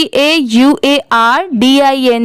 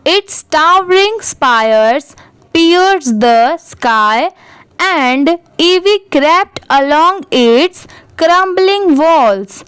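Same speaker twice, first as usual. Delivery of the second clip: very slow, word-by-word reading; teacher demonstrating slow reading aloud; careful model reading; much slower than usual. Shown as a demonstration. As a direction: read it out slowly for learners, pausing between words. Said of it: "पूरे paragraph को एक बार अच्छे से read".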